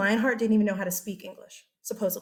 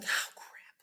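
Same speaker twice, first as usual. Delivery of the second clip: a sudden dismayed whisper; whispered oh crap; 11 dB quieter than usual. Retold or imitated. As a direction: breathe it out, caught off guard. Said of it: "Richard's like".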